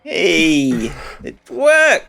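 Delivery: high excited exclamation. Real, not acted. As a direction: cheer that it worked. Excited the call works.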